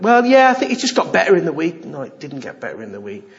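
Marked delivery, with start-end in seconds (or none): 0.0-1.8 higher, louder mimicry; imitated dismissive excuse. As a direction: mimic someone explaining it away, pitched up. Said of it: "they say things like".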